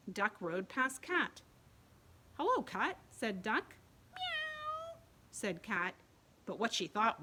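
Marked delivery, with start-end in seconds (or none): 2.4-3.0 cheery duck greeting. Acted. 4.1-5.0 very high cat meow; tiny high meow. Acted. Cat voiced.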